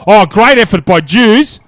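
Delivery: loud, slow, rising praise; excited call. Real, not acted. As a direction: call the play with a lift.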